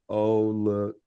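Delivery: low, slow delivery; slow read-out. Shown as a demonstration. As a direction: draw it out slowly and low. Trying out the translated heckle.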